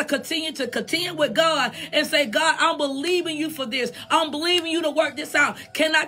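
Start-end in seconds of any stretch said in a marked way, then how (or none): none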